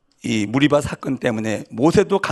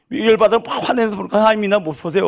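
angry retelling; 7 semitones higher, 7 dB louder than usual. Acted. Heated retelling of Moses' anger.

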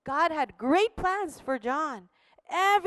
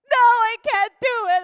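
anguished no; 8 semitones higher, 9 dB louder than usual. Acted. John at store, refusing again.

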